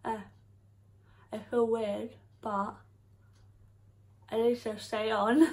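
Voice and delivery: through clear dental trays; speaking with trays on teeth. Real, not acted. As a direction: teeth covered, slight lisp. Seen 0: Trays fitted before; teeth look coated while she speaks after.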